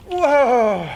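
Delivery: loud, drawn-out whoa; long whoa. Acted. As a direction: cry out as if skidding to a stop. Mouse zooms into hole, stops.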